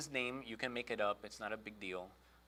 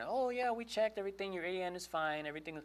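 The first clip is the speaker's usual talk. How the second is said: much higher, breezy agent voice; chirpy phone rep; 8 semitones higher than usual. Retold or imitated. Walmart lady on the phone reassuring him, high and chirpy. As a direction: sing it out like a cheerful call-centre lady.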